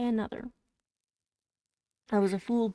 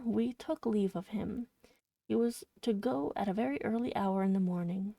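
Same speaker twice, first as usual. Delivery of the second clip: ordinary delivery, nothing marked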